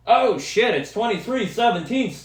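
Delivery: high, loud burst of excitement; real sports-fan outburst; excited cheering. Real, not acted. Suddenly reacts to game score, cheers.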